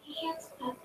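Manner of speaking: crying. Acted. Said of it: "You're crying".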